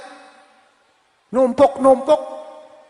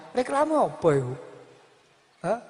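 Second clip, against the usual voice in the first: normal talking voice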